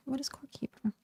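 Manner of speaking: hushed voiceless read; whispering. Real, not acted. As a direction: whisper the question softly. Reads chat question in a whisper.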